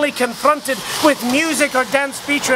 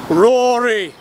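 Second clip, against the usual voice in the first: booming heavenly voice. Retold or imitated. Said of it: "a voice as deep as the ocean called out".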